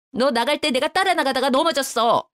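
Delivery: lightly voiced older woman insisting; mother-in-law sticking to her lie; defensive mother-in-law. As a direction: insist on the made-up story. Mother-in-law lying on phone.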